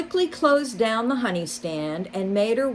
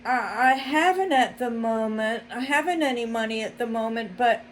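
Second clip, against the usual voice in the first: stammering mole; 5 semitones higher, faster than usual. A narrator's reading.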